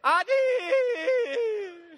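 high, loud, laughing boast; proud frog shout. Acted. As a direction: blurt it out like a proud frog cracking up.

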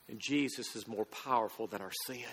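hushed, solemn emphasis. Real, not acted. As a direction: drop the voice for the key truth. Lowered sermon climax.

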